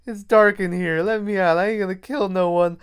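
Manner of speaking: plead, then demand to be let out; Chucky calling from closet. Acted. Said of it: "he hears Chucky in there like".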